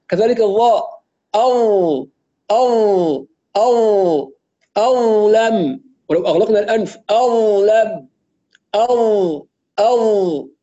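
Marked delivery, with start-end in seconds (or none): 1.3-10.5 أو held with rounded lips, slow; drill demo. Shown as a demonstration. Teacher drilling student on أو. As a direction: drill أو and أو لم slowly. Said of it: "هكذا يكون التدريب".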